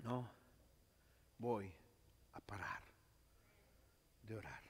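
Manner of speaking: very soft, intimate vow; hushed resolve. Real, not acted. Preacher to congregation, hushed and resolute. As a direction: drop to a near whisper. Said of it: "soft resolve after loud build".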